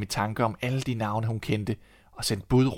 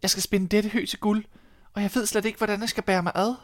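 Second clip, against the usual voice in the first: put-on much higher despairing girl; mildly distressed high girl; girl's voice; 11 semitones higher than usual. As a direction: voice the young girl high and helpless. Miller's daughter despairs.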